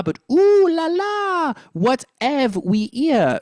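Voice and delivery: high, slow French accent, dropped H. Shown as a demonstration. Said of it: "So we're dropping the H again".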